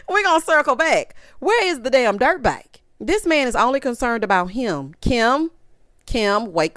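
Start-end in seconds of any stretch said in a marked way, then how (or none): none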